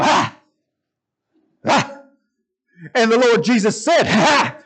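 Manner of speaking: mock fiery preacher, high and loud, with explosive "Ha!"; punched preacher exclamation. Preacher mimicking comedian's mad pastor, punched shouts. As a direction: imitate a pastor punching the end of each phrase. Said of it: "with that little punch at the end".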